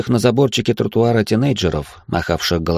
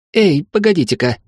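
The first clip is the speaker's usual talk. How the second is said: alarmed woman's voice; 10 semitones higher, 3 dB louder than usual. A narrator's reading. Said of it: "начала извиваться на стуле".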